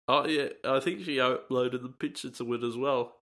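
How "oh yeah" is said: ordinary delivery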